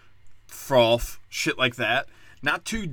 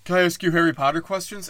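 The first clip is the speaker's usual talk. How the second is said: put-on higher, eager kid voice; 6 semitones higher, 4 dB louder than usual. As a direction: imitate a pushy young fan. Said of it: "He's like".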